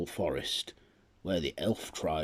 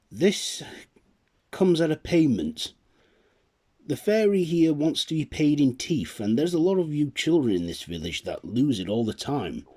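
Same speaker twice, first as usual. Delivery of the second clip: louder, raised voice to a crowd; calling out; 6 semitones higher, 5 dB louder than usual. Acted. Nicholas calling the village children to pay in teeth, announcing.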